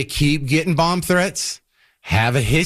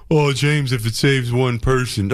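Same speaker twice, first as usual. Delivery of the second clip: mock critic; 2 semitones lower than usual. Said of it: "mocking imagined critic".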